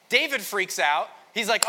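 ordinary talk, nothing marked

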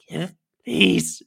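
wailing lizard plea; desperate pleading. Acted. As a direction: beg for the sun. Captive begging for warmth.

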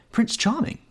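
very high-pitched, thrilled naming; excited answer. A narrator's reading. Girl points out her beloved to her brother, excited. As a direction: say it high, thrilled to see him. Excitedly names man she just spotted.

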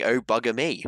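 lightly hammed-up received pronunciation; a touch of over-the-top RP; posh British swearing. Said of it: "swear like a posh person in Britain".

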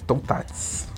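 "Toutatis" breathed with no voice; whispered name. Shown as a demonstration. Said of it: "tem que falar com sotaque francês".

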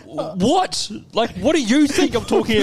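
high, drawn-out mock disbelief; exaggerated indignant comeback; incredulous retort. Acted quarrel with fast-food worker.

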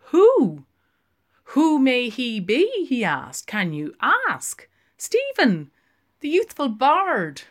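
loud, high grand flourish; theatrical exclamation. Acted. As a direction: declaim it with a flourish.

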